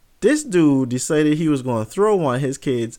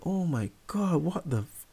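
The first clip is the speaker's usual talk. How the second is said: hushed disbelief; 4 semitones higher, 9 dB quieter than usual. Retold or imitated. Son seeing the huge dog stand up, hushed disbelief. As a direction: trail off quietly before the swear word. Sees huge hellhound rise from grass.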